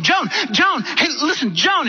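high-pitched frantic call, no real scream; mock screaming. Woman phoning her sister in a panic, high mock screaming. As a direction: imitate urgently calling down the phone. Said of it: "she started screaming on the phone".

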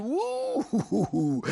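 long, drawn-out "woo" of awe; whistle-like whoop at someone's nerve; admiring whoop. Real, not acted. Host marvels at the writer's strong pen, admiring whoop.

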